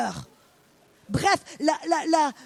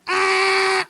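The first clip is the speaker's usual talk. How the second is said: loud high game show buzzer cry; acting out a quiz buzzer with a loud cry; buzzer shout; 5 semitones higher, 6 dB louder than usual.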